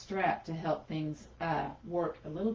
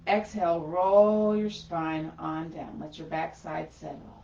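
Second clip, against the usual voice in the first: drawn-out and louder, stretched long; slow guided cue; 5 dB louder than usual. Acted. Yoga teacher guiding the class down, slow drawn-out cue. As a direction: draw every word out like the spine rolling. Cueing slow exhale movement.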